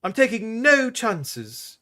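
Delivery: high, slow and decided; high deliberate insistence; firm princess. A narrator's reading. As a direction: lay down the law to her brother. Carline insisting.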